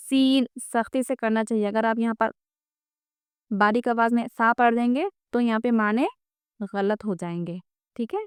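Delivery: ordinary delivery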